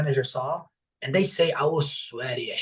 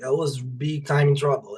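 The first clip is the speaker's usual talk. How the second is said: ordinary talk, nothing marked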